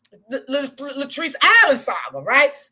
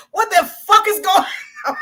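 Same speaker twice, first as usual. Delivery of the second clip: loud, high retold disbelief through laughter; laughing outburst; 8 semitones higher, 4 dB louder than usual. Telling viewers how the court-like place felt, laughing disbelief. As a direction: exclaim it, breaking up laughing. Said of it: "but I'm laughing".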